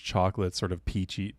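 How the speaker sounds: normal talking voice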